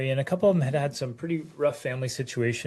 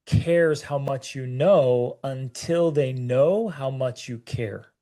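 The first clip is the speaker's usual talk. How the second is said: slow quote delivery; 2 semitones lower, slower than usual. Shown as a demonstration. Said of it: "kind of quote that says".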